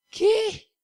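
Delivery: high, loud incredulous what; played-up surprise at giving over receiving; mock disbelief. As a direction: squeak it in comic shock. Reacting to a scripture verse.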